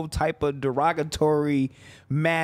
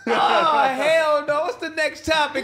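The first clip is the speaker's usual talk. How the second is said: laughing; 9 semitones higher than usual. Real, not acted. Mic touched by bleeding guy's lip.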